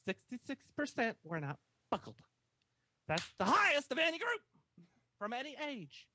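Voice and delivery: put-on sassy southerner accent. Scolding listeners over seat belts, sassy Southern accent. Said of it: "This is his sassy southerner accent".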